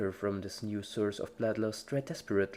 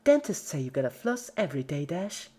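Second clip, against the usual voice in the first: Pinky voice; 7 semitones higher, 5 dB louder than usual. Acted.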